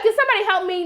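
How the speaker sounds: high-pitched imitated call for help; mock plea. Mom struggling to get her out of the tub, mock plea.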